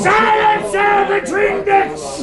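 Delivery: captain's outraged bellow; furious shout. Acted. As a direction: roar it high and loud across the room. Captain roars at the doctor.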